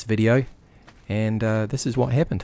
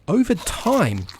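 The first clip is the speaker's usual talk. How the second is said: over time, with time hit high and lingered on; stress on time; 10 semitones higher, slower than usual. Shown as a demonstration. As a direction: lean hard on the word time. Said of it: "I emphasize the word time".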